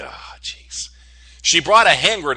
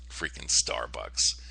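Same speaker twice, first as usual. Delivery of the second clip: whispered under the breath, slow and much softer; exasperated mutter; 13 semitones lower, 10 dB quieter, slower than usual. Real, not acted. Fed-up viewer grumbling at Starbucks, whispered and slow. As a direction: let the gripe slip out as a near-silent sigh. Griping about the overpriced card.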